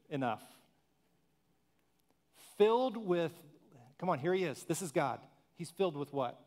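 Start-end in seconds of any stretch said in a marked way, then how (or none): none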